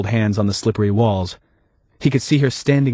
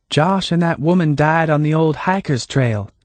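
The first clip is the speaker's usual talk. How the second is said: higher, lightly voiced Hannah; Hannah, calm and knowing; 7 semitones higher than usual.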